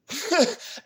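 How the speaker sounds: a sudden high burst of laughter; giddy high-pitched laugh. Real, not acted. Burst of delight after relief.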